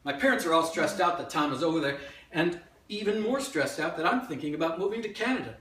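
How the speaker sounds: loud, climbing stage monologue; worked-up memoir narration; urgent storytelling. Acted. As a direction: tell it to a crowd, building in pitch. Performs a written memoir monologue.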